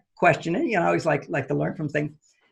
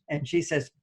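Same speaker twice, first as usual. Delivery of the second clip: ordinary delivery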